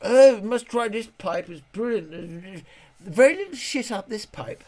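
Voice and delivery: high, bright chirpy fish chatter; goldfish voice. Pretend. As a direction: voice an excited goldfish exploring pipes. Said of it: "they're on their iPhones".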